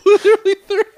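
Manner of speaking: high laughing words; laughing at the absurdity. Real, not acted. Laughing while telling.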